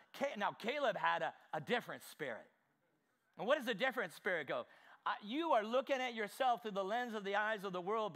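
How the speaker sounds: usual speaking voice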